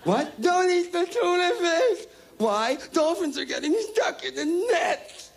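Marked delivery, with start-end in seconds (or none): 0.4-2.1 even higher, slower, wailing plea; whiny activist. Retold or imitated. Mocking an animal rights activist. 2.9-5.3 high, slow, weepy complaint; whiny activist. Retold or imitated. Mocking an animal rights activist.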